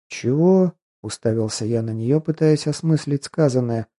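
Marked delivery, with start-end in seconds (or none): 0.1-0.7 high, startled what; stunned disbelief. Acted. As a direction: blurt it out in shock. Stunned reaction to her claim.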